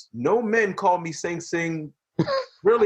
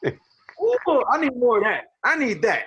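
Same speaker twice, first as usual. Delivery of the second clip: higher, hammed-up thrilled sigh; playful mock craving for more; mock delight; 9 semitones higher, 3 dB louder, slower than usual. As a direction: gush like he can't get enough. Imagining ladies saying his name.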